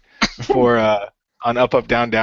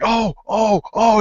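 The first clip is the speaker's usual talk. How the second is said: retold pain; 11 semitones higher, 5 dB louder, slower than usual. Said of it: "and he's like".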